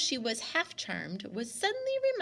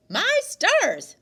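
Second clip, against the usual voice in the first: loud, much higher gasp of surprise; startled exclamation; 11 semitones higher, 12 dB louder than usual. Acted. Grandmother hearing the music from the wood, startled. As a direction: blurt it out, astonished.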